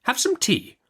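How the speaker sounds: high, brisk stern aunt; Miss Betsy. Acted. Aunt Betsy interrogating the young mother, brisk and high. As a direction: snap the question like a stern old aunt.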